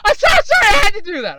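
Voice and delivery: very high, loud, cracking up; laughing apology. Real, not acted. Host apologizing for own meme joke, helpless laughing. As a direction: squeal it out through laughter.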